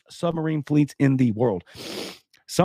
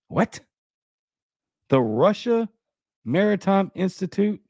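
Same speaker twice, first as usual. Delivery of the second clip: baffled, higher-pitched questioning; incredulous; 5 semitones higher than usual. Real, not acted. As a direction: repeat the name in disbelief. Baffled at institute's name.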